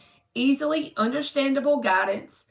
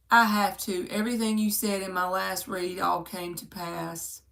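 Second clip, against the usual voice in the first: ordinary talk, nothing marked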